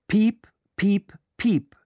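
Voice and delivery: high, imitated electronic beeps; high-pitched mimicked tape tones; answering-machine beeps. A narrator's reading. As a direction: squeak out a row of beeps. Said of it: "kam es vom Band".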